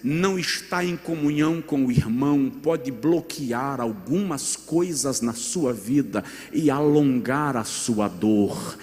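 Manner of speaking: ordinary talk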